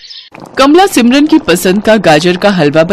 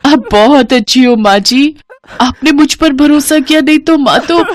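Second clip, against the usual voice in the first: higher, crying thanks; tearful gratitude; 7 semitones higher than usual. A narrator's reading. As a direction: thank her through tears. Bride crying with relief. (रोते हुए आभार)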